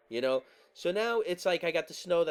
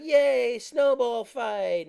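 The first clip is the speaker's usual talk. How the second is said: fake delight; 6 semitones higher, 7 dB louder, much slower than usual. Mock joy at being pelted.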